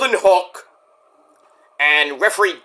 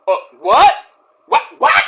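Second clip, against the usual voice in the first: sputtered whats leaping up high; mock disbelief; 6 semitones higher, 5 dB louder than usual. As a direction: react as if you can't believe the accusation. Retold disbelief at being called jealous.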